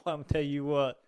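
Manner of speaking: ordinary talk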